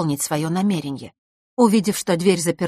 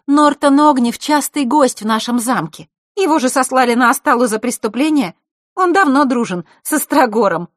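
high, louder matter-of-fact telling; knowing girl; 8 semitones higher, 7 dB louder than usual. Acted. Scene: Zakhara telling about Vasilisa's father, high and loud. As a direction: let her know pointedly.

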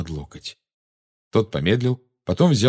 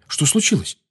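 higher, alarmed question; frightened; 8 semitones higher than usual. A narrator's reading. Harry asks what happened to Ginny, frightened. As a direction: ask in worried alarm. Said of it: "испуганно сказал Гарри".

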